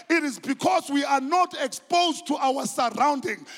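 loud, rising preacher's cry; preaching at full force. Acted. Preacher urging the congregation to open their eyes, shouting. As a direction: drive it home to the congregation.

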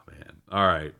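usual speaking voice

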